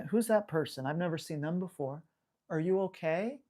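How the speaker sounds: hushed nervous pup; worried puppy voice. Retold or imitated. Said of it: "a puppy that may show some concern".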